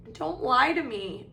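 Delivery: mildly tearful accusation; gasped hurt. A narrator's reading. Ghost girl accusing them as tears flood, gasping. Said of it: "Myrtle gasped".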